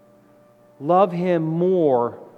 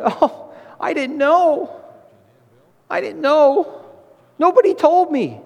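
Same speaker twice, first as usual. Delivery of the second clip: mock wailing; 11 semitones higher, 4 dB louder than usual. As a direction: imitate the damned lamenting before the throne. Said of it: "and wailing, going".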